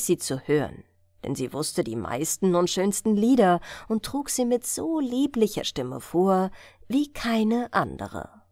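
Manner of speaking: ordinary delivery, nothing marked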